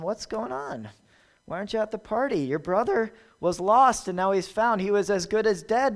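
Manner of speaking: a father urging his son to join the party; urgent father's plea. A narrator's reading.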